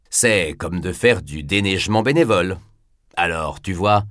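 ordinary delivery

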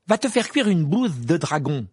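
a flushed, furious comeback; angry retort. A narrator's reading. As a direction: spit it out red-faced with anger. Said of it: "répliqua Ron".

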